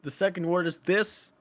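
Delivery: high, loud prompting; impatient shouting. Pretend. Director feeding actor the first words, impatient shout.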